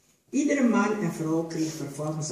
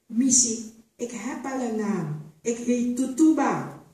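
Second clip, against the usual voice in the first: higher, firm and slow on her own name; defiant; 6 semitones higher, 3 dB louder than usual. A narrator's reading. Tutuba refuses the new name to the mistress, defiant. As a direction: stand your ground, looking her in the eye.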